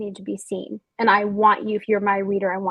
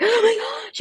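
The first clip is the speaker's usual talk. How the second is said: sudden high gasping oh my gosh; mock shock; 13 semitones higher, 5 dB louder than usual. Author mimicking going viral, high and excited. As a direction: play the stunned viral moment.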